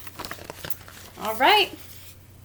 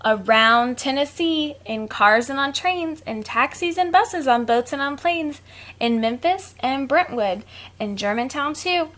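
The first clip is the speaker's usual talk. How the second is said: reading a rhyme; 3 semitones higher, 3 dB louder than usual. A narrator's reading. Reads rhyming book aloud.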